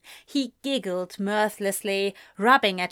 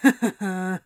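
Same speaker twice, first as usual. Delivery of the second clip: short, mirthless acted laugh; a dry, bitter chuckle in character; 4 dB louder than usual. A narrator's reading. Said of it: "Anthony laughed bitterly".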